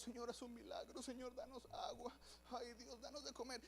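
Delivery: soft, whiny begging; mock-plead for water and food; pleading Israelites. Israelites begging God in the desert, soft and whining.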